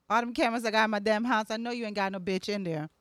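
ordinary talk

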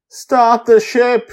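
high, loud, slow plea; begging. A narrator's reading. Fleeing mother asks Grandpa Walt to sail, begging. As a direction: beg urgently, stretching the words. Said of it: "she begs me".

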